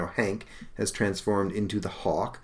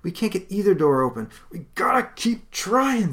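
much higher, strained hero voices; young heroes; 11 semitones higher, 3 dB louder than usual. Acted. Brothers slamming the door, strained insistence.

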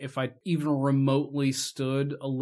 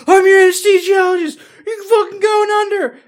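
much higher and louder mock doctor voice; mock anesthesiologist; 12 dB louder than usual. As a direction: ham up the doctor's squeal, high and loud.

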